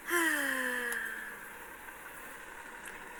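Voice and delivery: long, soft sigh falling from high. Real, not acted. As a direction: let out a drawn-out sigh. Stressed, sighing aloud.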